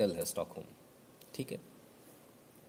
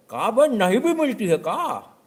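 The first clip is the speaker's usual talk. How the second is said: much higher, louder, slow sneering voice; rough local tough; 10 semitones higher, 8 dB louder, slower than usual. Retold or imitated. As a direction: drag the question out mockingly. Customer mocking shopkeeper.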